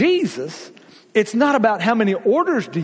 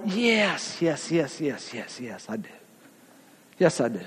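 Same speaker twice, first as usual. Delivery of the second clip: fading repeated yes; 5 semitones lower, 7 dB quieter than usual. Real, not acted.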